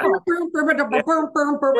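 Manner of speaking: loud, high booming band noises; imitated drum booms. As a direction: boom it out like a marching drum. Imitating a Mardi Gras band.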